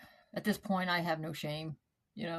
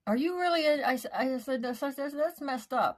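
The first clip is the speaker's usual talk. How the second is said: shocked disbelief; 7 semitones higher than usual. Retold or imitated. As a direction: voice jumps high in retold disbelief. Shocked that he knows her records.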